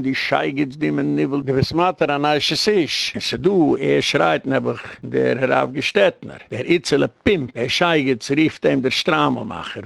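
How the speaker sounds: normal talking voice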